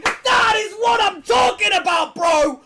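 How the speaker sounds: huge high, loud shouts, drawn out; ecstatic shouting. Real, not acted. Streamer to viewers as the bonus lands, ecstatic shouting. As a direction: explode with joy at a big win. Big slot win celebration.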